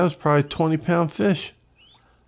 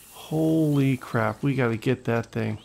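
usual speaking voice

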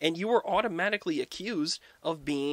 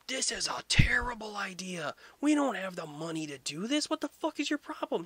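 high-pitched, strained protest; hammed-up exasperation, voice climbing very high; mock outrage; 7 semitones higher than usual. French court objecting to the king's costly war, mock outrage.

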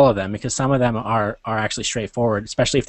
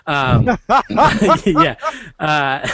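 high laughing yeah; 6 semitones higher, much slower than usual. Real, not acted. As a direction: laugh through the word. Laughing after spoiler.

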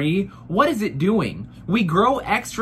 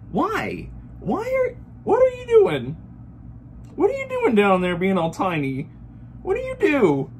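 much higher squeal of disbelief; squeaky mock outrage; 9 semitones higher than usual. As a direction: squeal at your tiny nipples. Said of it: "addresses nipples".

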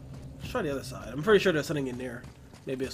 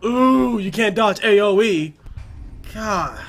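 loud, higher frustrated yell; frustrated shout; 5 semitones higher, 10 dB louder, slower than usual. Real, not acted. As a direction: yell in disbelief at the game. Hit by unavoidable attack.

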